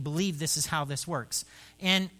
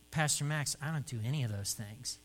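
dejected confession; 5 semitones lower, 6 dB quieter than usual. Retold or imitated. Dennis confessing to his pastor, low, soft and dejected. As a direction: lower and softer, deflated. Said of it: "shoulders slump, looking at the ground".

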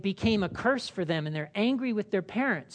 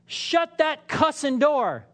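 high, loud, slow snap; yell; 8 semitones higher, 5 dB louder, slower than usual. Acted. Parent yelling at child at the fridge, loud and high. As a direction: bark at the kid by the fridge.

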